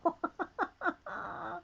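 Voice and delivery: soft high giggle; amused laugh. Real, not acted. Viewer says we have several, amused giggle.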